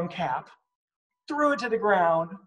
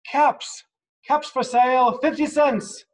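high, louder peddler's cry; street vendor's call; 8 semitones higher, 4 dB louder than usual. Acted. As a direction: sing it out to passersby. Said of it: "walked up and down the street calling".

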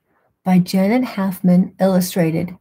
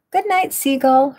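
lightly called out, high-pitched; a gentle high call from a little pup; mild sing-out goodnight; 7 semitones higher than usual. A narrator's reading. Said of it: "her little pup called".

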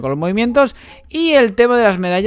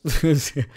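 brief chuckle while talking; short laugh. Real, not acted. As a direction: let out a small laugh. Laughing during match commentary.

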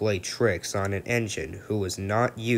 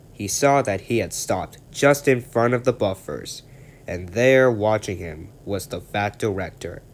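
ordinary delivery, nothing marked

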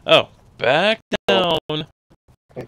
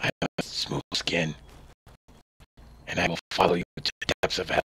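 low softer raspy ghoul; 9 semitones lower, 10 dB quieter, faster than usual. Acted. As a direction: growl it like an infected throat. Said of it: "It's not that hard to actually do a ghoul voice".

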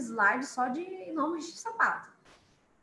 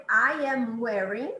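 slow model phrase; 5 dB louder, slower than usual. Shown as a demonstration. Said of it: "Eu, por exemplo".

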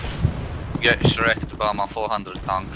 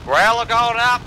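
loud, much higher callout; callout shout; 12 semitones higher, 6 dB louder, slower than usual. Real, not acted. Player announcing his support vehicle to the team, loud high callout. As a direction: announce it to the whole squad.

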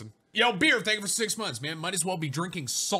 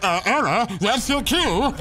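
loud, higher-pitched mock voice; imitating a teammate; 5 semitones higher, 6 dB louder, slower than usual. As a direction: mimic the teammate, loudly hammed-up. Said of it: "he was like".

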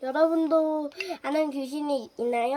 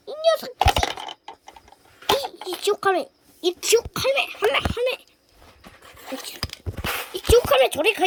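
high, loud frightened yell; panicked yelling; 8 semitones higher, 4 dB louder, faster than usual. Pretend. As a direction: shout the ghost granny away. Yelling at the hell granny.

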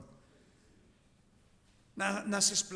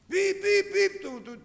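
high, loud mocking beep-beep of a horn; car horn beeps; 10 dB louder, slower than usual. Preacher mimics the horn of an angry driver, loud and high.

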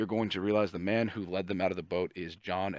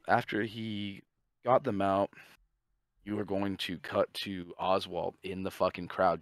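normal talking voice

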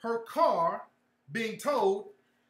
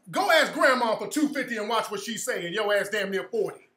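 normal talking voice

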